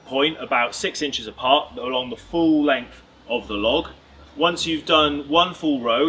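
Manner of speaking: ordinary talk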